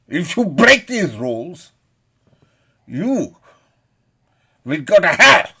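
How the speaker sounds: angry declamation, rising to a roar; thundering condemnation. Real, not acted. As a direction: pronounce the rules like a furious judge. Thunders religious rules at the offender.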